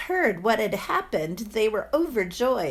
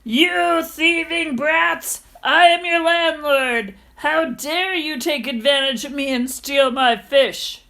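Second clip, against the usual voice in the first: angry shout; 5 semitones higher, 6 dB louder than usual. Acted. Landlord shouts at the boys who stole his fish, angry yelling. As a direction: bellow at them with wounded pride. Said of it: "With an angry shout".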